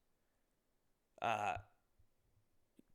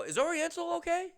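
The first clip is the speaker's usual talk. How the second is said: acted, very high querying voice; pitched way up, hammed-up doubt; high-pitched questioning; 3 dB louder than usual. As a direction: squeak out a mock worried question. Acts out puzzled reaction to signs.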